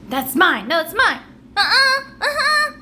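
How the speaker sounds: loud, high back-and-forth squabble; two twins bickering over clothes; bickering children. Acted.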